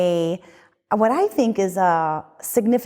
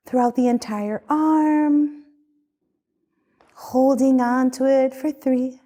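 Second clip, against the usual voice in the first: guided meditation. Acted. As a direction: lead them gently through the body. Leads a guided body scan.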